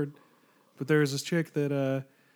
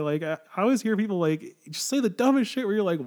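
ordinary talk

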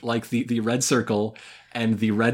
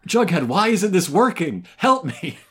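loud, high-pitched pretend panic; hammed-up cry for help; mock panic; 9 semitones higher, 4 dB louder than usual. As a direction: play a hapless hero panicking. Imagined panicking Archie throwing matches.

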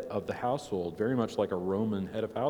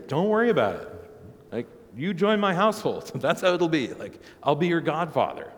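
lightly voiced generous patron, higher and louder; bishop reassuring converts; 7 semitones higher, 5 dB louder than usual. Bishop to new converts out of work, loudly reassuring. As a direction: offer protection with raised, emphatic voice. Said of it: "Your bishops are literally saying".

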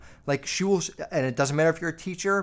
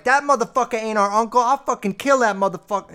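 loud, high-pitched mock tirade; imitating enraged Italian mom; 8 semitones higher, 9 dB louder than usual. Imitating Italian mother going off on dad about uncle, furious yell. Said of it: "It's like this Italian woman that's, like, all of a sudden".

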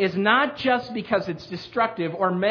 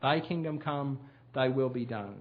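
soft, low echo of the phrase; quiet repeat; 5 semitones lower, 8 dB quieter than usual. Shown as a demonstration. Preacher quietly repeating the prayer to let it sink in. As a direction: murmur it back to yourself. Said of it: "Not the words, but the meaning".

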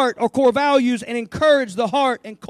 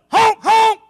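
loud, higher cheering honks; encouraging geese; 5 semitones higher, 5 dB louder, slower than usual. Pretend. Geese at the back cheering the leader, loud and higher. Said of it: "they're sitting back there going".

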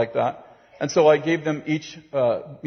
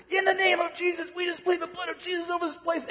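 frantic praying; 14 semitones higher, 6 dB quieter than usual. Retold or imitated. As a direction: re-enact a desperate, high-pitched prayer. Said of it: "Let's pray harder".

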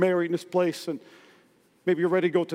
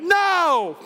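emphatic no; 8 semitones higher, 3 dB louder than usual. Real, not acted. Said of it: "exclaimed rebuttal".

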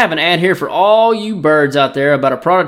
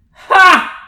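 quick high ha; a bright yelp of a laugh; short high laugh; 13 semitones higher, 4 dB louder than usual. Acted. Laugh inside fake ad.

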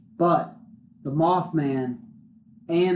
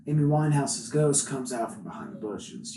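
ordinary talk, nothing marked

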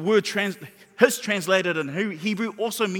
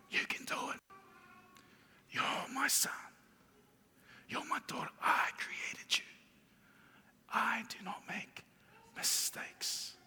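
God's whisper; 4 semitones higher, 15 dB quieter than usual. Acted. God speaking to a child of his, gently whispering. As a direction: whisper tenderly, with long pauses between phrases. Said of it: "God whispering in your ear".

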